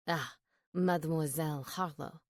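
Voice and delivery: put-on smooth French accent. A narrator's reading. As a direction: greet her with a thick French accent. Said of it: "his thick French accent".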